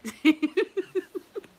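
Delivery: real high cackling laugh; high laughter. Real, not acted. Telling viewers about the checkout, high loud laugh.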